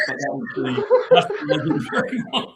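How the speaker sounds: words breaking up into real laughter; laughing while talking. Real, not acted. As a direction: crack up as you finish. Teller cracks up at own story.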